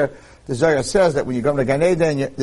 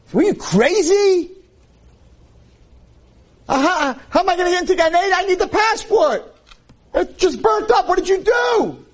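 panicked outrage. Acted. Man yells at the angel who burned his passport, frantic high shout. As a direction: shriek in disbelief at the loss.

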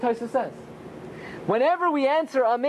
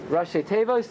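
normal talking voice

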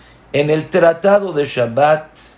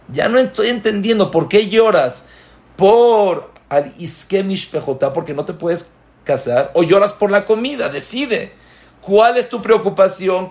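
higher, pressing, exasperated questioning; exasperated demand; 6 semitones higher than usual. Acted. Rabbi challenging the weeping people, exasperated questions. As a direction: demand they decide, with mounting disbelief.

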